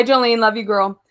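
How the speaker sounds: ordinary talk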